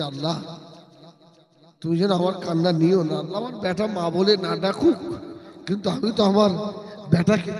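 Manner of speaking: mother praying in tears. Acted. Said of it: "মা কেঁদে কেঁদে বলছে".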